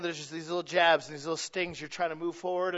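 ordinary talk

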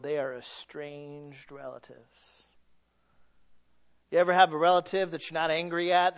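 ordinary talk, nothing marked

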